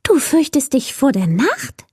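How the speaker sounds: high, disbelieving little voice; incredulous creature. A narrator's reading. Will-o'-the-wisp to Mila, incredulous high question. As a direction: question it with a big final rise. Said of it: "fragte das Irrlicht ungläubig".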